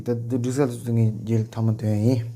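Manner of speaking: ordinary talk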